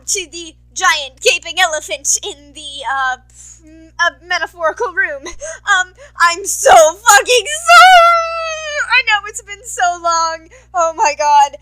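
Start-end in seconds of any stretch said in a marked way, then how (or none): 6.2-11.6 high, loud, drawn-out wail; wailed apology. Acted. Vlogger apologizing to listeners for a long absence, wailed loudly. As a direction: shout the apology, stretching it right out.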